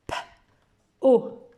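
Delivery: O said high and loud; teacher modelling sounds; sounding out letters. Shown as a demonstration. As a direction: separate P and O. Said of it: "je commence par P".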